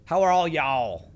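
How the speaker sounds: put-on loud, high Texan accent; full-on Texan. Streamer greeting chat, full-on Texan accent. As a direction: lay on a big Texan twang. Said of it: "turn full on Texan here".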